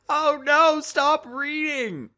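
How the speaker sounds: very high, loud, slow wail of horror; horrified cry. Acted. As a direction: plead desperately for her to stop. Journal read aloud in class.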